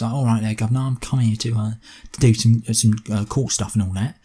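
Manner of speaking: casual put-on Essex accent, rough around the edges; imitated Essex accent. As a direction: mumble it in mock Essex. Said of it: "shows up talking all like this".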